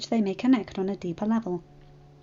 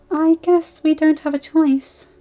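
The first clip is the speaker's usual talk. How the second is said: resigned sigh; 7 semitones higher, 7 dB louder, slower than usual. Acted. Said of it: "Fluttershy sighed".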